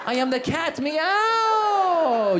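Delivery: put-on high, drawn-out meow in an Iranian accent; friendly Persian cat. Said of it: "we smile".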